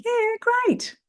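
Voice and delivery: very high, fake-positive reply. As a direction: squeak out a reply you don't mean. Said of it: "see who raises their voice when they answer".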